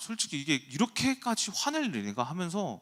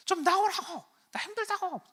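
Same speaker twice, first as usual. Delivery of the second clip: very high-pitched mock yell; imitated wife yelling. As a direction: shriek like a fed-up wife outside the door. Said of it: "밖에서 막 소리 질러요". (소리 지르는 아내 흉내, 아주 높게)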